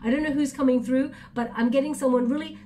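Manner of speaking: ordinary talk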